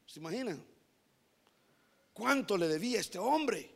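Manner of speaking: ordinary talk, nothing marked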